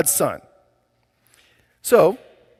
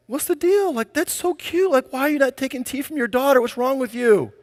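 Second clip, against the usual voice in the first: high-pitched, incredulous complaint; acted-out offended dad, voice jumping way up; indignant protest; 9 semitones higher than usual. Dad to mom who refused daughter's tea, indignant and high-pitched.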